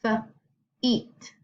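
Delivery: "feet" split after the F; feet sounded out. Shown as a demonstration. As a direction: say F, pause, then the rest of "feet". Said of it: "think about the sound that the vowel makes".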